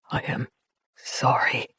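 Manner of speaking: put-on a freezing boy apologizing, fading to a whisper. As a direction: let the words die out. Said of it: "as a gasp through chattering teeth".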